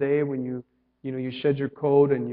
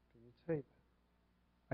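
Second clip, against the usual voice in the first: softly and slowly, almost to yourself; quiet, slow question; 3 semitones lower, 15 dB quieter, much slower than usual. Shown as a demonstration. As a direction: drop the voice and slow right down on "say". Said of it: "I think you can say that".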